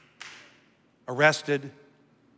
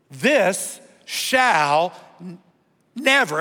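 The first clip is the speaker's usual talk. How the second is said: slow drawn This shall, then NEVER louder; emphatic never; 3 dB louder, much slower than usual. Shown as a demonstration. Preacher leading congregation in saying never, emphatic. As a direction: lead the crowd into NEVER. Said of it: "everybody say never".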